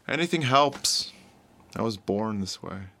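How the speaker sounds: soft, subdued plea; broken-spirited, tired. Acted. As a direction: plead quietly, worn down and defeated. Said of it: "his spirit has been broken".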